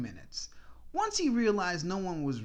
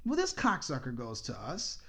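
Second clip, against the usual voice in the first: ordinary delivery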